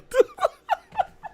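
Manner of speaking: real high-pitched laugh. Real, not acted. Laughing at the clip.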